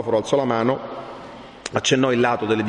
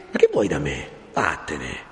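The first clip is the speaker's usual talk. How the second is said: stern dismissal; 6 semitones lower than usual. Acted. Friar tells Renzo to leave, stern. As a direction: voice dropping low and final.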